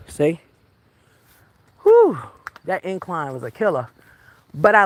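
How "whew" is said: high, loud exhale of exertion; winded whew. Real, not acted. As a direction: let out a big whew after the climb. Winded after a steep climb.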